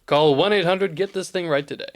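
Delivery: low announcer reading the hotline; deep announcer voice. Acted. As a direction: sell the phone number low and firm. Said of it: "just an ad for jars".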